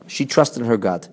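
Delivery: usual speaking voice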